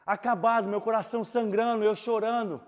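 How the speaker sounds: loud, high preaching of his own pain; fervent testimony preaching; impassioned preaching. Acted. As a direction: cry it out to the church.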